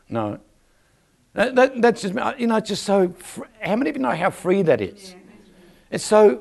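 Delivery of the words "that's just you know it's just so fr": usual speaking voice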